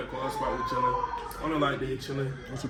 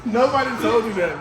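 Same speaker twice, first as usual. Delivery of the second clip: laughing, shouted louder; 6 semitones higher, 6 dB louder than usual. Real, not acted. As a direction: yell it through laughter. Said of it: "repeated louder with laugh".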